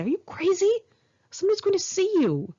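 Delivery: high, fast panicked whisper-shout; alarmed. Retold or imitated.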